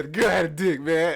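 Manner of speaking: high, very loud outburst; distraught confession. Retold or imitated. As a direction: blurt it out as the panicked friend. Friend's shocking confession reenacted.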